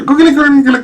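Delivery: put-on high, loud gleeful baby babble; mock baby gurgling with glee; happy baby. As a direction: coo like a delighted baby.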